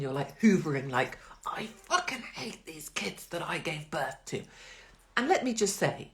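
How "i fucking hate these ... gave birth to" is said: mock fury, much softer; muttered angry thought. Mother hoovering, muttering fury at her kids. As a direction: grumble it under your breath while hoovering. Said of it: "when you're being so angry".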